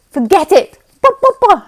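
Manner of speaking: loud, high mock outburst; a hammed-up grumpy blast; abrasive. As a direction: bark it out, self-mocking. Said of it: "and I'm so abrasive".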